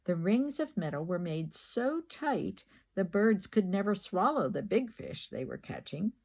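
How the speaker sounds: ordinary delivery, nothing marked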